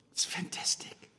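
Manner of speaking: breathy awed whisper. Real, not acted. Preacher relishing Jesus's clever reply, hushed whisper. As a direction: drop to a delighted whisper.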